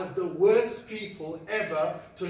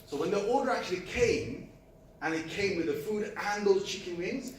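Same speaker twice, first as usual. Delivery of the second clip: ordinary talk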